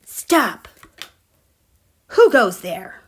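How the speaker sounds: guard challenge. Pretend. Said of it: "It's like".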